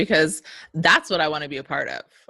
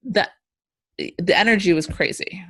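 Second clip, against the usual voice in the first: ordinary talk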